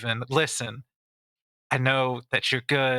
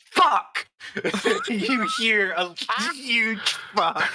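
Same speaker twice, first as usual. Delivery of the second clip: high shout; 10 semitones higher, much slower than usual. Acted. Broxton's shouted curse.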